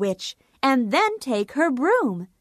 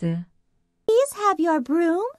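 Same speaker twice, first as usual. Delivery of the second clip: nervous but brave. Acted. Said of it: "Dorothy was very scared, but she tried to be brave".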